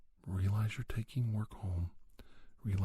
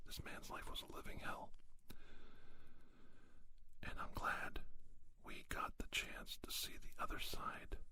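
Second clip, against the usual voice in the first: soft, breathy whisper with long pauses; hushed whispering; 3 semitones higher, 10 dB quieter than usual. Real, not acted. Whispering to listeners about being kind to a difficult boss, hushed. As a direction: whisper it softly, leaving room between thoughts.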